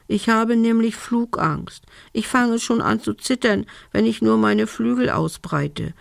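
ordinary delivery

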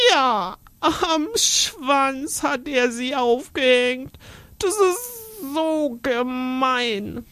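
put-on slow, high, sobbing little girl; crying girl. Girl complaining to her aunt, sobbing. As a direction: whimper it out through tears.

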